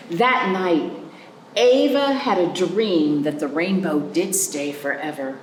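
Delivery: plain reading voice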